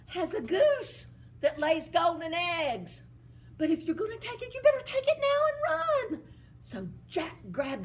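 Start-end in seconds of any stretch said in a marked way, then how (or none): 0.1-6.2 put-on high, urgent servant girl voice. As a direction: hurry him along, rising and louder. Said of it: "And she said".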